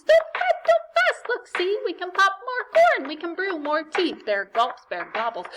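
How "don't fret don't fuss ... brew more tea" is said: very high, loud squeaking reassurance; squeaky mouse. Acted. Mouse comforts the crying bear, very high and squeaky. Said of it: "Mouse squeaks".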